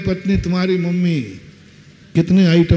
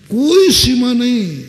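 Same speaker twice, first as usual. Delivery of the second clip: marvelling emphasis; 7 semitones higher, 4 dB louder than usual. Real, not acted. Marvelling at endless dishes.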